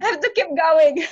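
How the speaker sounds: pushing on through tears, voice up; real teary resolve, still choked. Real, not acted. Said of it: "I'm a bit teary today".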